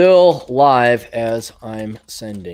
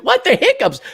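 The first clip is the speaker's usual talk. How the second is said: mock incredulous.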